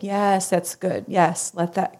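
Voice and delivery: very soft and slow, gently encouraging; soothing therapist. Retold or imitated. Therapist softly urging her to let the tears come. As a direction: hold space for someone crying.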